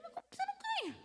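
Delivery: high, disbelieving question; incredulous. Retold or imitated. Financial advisor stunned by client's answer, incredulous high question.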